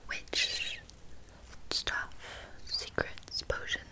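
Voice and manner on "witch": ordinary talk, nothing marked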